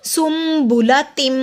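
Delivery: सुम्बुला said slowly with the म joined; slow tajweed demo. Shown as a demonstration. Teacher demonstrating recitation to students. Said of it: "मैंने सुन नहीं पढ़ा".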